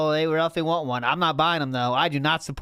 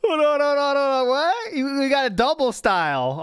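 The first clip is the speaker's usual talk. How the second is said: high, urgent disbelief; excited surprise; 10 semitones higher, 3 dB louder than usual. Real, not acted. Host halting things for a double answer, excited surprise. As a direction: break in high, amazed at the bonus.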